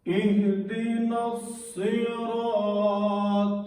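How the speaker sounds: slow, drawn-out melodic tajweed chant; Quran recitation. Shown as a demonstration. Teacher reciting Fatiha for students, slow melodic chant. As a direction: chant each verse slowly and melodically.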